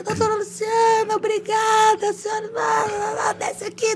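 very high, held-back mock shouting; imitated distant calling. Team on the truck calls her down, distant mock calling. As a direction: mimic a crowd calling from afar. Imita homenagem gritada do caminhão.

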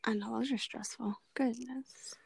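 hushed whisper, barely voiced; soft awed whisper. Real, not acted. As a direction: breathe it out under your breath. Reacting to stressful triple eviction.

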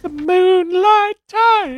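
high, drawn-out mock-mystical croon; dreamy awe. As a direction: stretch the name out high, like a dream. Dreamily echoes the lovely name.

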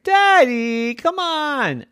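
high, drawn-out wheedling; pleading child. A narrator's reading. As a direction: beg like a little girl at bedtime.

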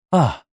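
put-on hoarse elderly cough; old man cough. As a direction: cough hard like old grandpa goat. Grandpa goat coughing.

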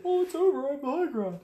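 high, mocking movie quote; exaggerated high-voiced taunt; mock Obi-Wan. Imitates Obi-Wan's famous line.

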